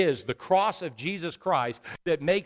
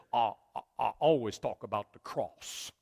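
put-on North Carolina accent; 6 semitones lower, 6 dB quieter than usual. Preacher quotes Billy Graham answering his pastor, North Carolina accent. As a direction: imitate a backwoods North Carolina preacher, pitched down. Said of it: "In his North Carolina deep woods accent".

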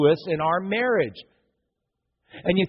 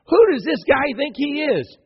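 high, mocking sneer; scornful; 8 semitones higher than usual. Townsfolk scoffing at Jesus, high and mocking. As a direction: sneer it like a jealous neighbour.

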